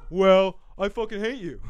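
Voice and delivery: hammed-up angry retort; mock arguing. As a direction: snipe back as the natural-voiced partner. Acting both sides, own register.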